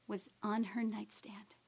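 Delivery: slow, soft whisper. Real, not acted. Describing the boarding pass left behind, slow whisper. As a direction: linger on it, barely voiced.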